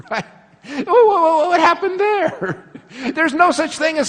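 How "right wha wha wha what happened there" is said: high, loud and laughing, slowing down; laughing disbelief. Real, not acted. Preacher laughing to the congregation at the absurd idea. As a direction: crack up as you ask it. Laughing at his own joke.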